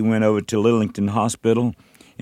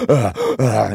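strained wordless gasps; gasping for breath. Shown as a demonstration. Singer showing how he struggled to sing, gasping for breath. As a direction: imitate straining to get air and words out. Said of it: "couldn't hardly catch my breath".

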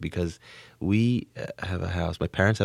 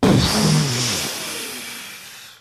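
loud, long blown breath; exaggerated imitation of a smoker's big exhale; puffed-out exhale; 5 semitones higher, 3 dB louder than usual. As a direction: blow out smoke through puffed cheeks. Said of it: "he'd puff his cheeks out and go".

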